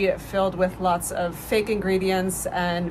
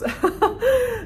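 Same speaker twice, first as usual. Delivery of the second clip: high burst of laughter. Real, not acted. Laughs after a slip.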